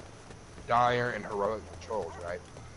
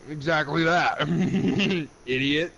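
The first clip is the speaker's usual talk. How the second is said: laughing as he speaks; 4 semitones higher, 5 dB louder than usual. Real, not acted. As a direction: laugh through the words. Laughing at friend's reply.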